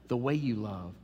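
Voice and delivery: normal talking voice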